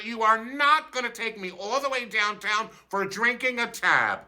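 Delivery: high, fast diva protest; hammed-up outrage. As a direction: rant at the cops, scandalized. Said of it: "I'm literally like".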